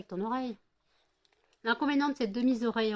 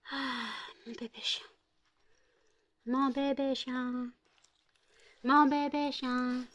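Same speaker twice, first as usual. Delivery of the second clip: doting coo; 3 semitones higher, slower than usual. Real, not acted. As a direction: stretch the words, adoring. Doting on the dog.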